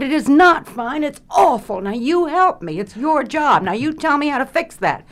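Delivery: high, loud insistent pleading; badgering the director for help; a younger actress begging her director to fix her scene; retold pleading.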